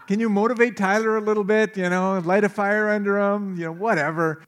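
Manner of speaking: egging her on about grandkids; playful nudging. Retold or imitated. Dad teasing daughter about grandkids.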